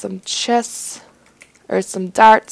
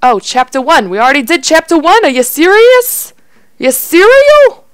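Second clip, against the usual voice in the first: loud disbelief climbing higher and higher; exasperated outburst; 8 semitones higher, 9 dB louder than usual. Acted. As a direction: build to a high, loud, incredulous yell. Protests at repeated chapter one.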